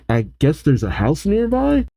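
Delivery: ordinary delivery, nothing marked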